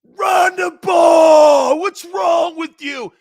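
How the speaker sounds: very loud, high, slow angry scream; furious fan scream. Retold or imitated. Angry fan in the stands screaming at the coach. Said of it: "angry as all hell".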